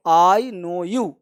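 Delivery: "I know you" spelled out very slowly, each word apart; slow model answer. Shown as a demonstration. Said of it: "ये दोनों का अंग्रेजी".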